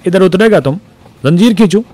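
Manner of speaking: high, loud teasing Pathan voice; mock the clerk with a snicker. A narrator's reading. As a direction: Pathan jeering at the excited clerk. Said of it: "खी खी करके हंस दिया".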